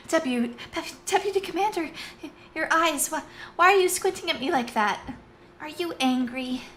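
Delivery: timid subordinate facing her stern boss. Acted. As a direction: stammer it out, worried she's in trouble. Marcia nervously addresses Tanith.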